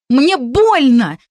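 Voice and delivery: loud, slow, wounded yell; hurt cry. A narrator's reading. Girl shaken by her captor, crying out in pain and offence. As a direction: cry out that he's hurting you. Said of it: "закричала обиженно".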